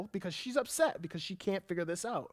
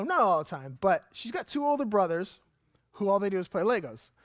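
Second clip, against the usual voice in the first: ordinary talk, nothing marked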